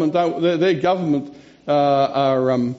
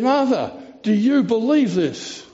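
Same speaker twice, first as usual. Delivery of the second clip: voicing Jesus; 7 semitones higher than usual. Jesus asks Martha.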